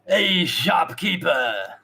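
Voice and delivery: loud, high, drawn-out call; another bandit shouting. Acted. Another bandit calls to shopkeeper from outside, drawn-out shout. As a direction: call out to someone inside. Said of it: "And then you hear another voice".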